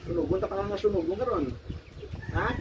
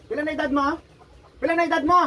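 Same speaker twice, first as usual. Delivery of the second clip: higher, louder questioning; demanding shout; 9 semitones higher, 6 dB louder, faster than usual. Real, not acted. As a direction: press the spirit hard for an answer. Healer interrogates the possessing spirit.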